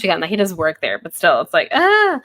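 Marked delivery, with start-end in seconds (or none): 1.7-2.2 high, loud mock "ah" of shock; mock shocked cry. Recapper reacting to Luke standing there, mock shocked cry. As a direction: yelp as if caught off guard.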